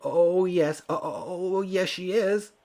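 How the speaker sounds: high, flustered shout while fleeing. Acted. Frightened old man shouting back as he flees. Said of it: "shouted the man".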